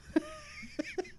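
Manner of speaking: high squealing laugh; real laugh. Real, not acted. Host amused by his own impression, high laugh. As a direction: crack up at your own bit.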